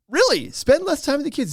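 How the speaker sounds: high, loud disbelieving reply; shocked host. Retold or imitated. Host reacting to the guest, high and incredulous. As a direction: squeak it out in disbelief. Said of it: "And the guy's like".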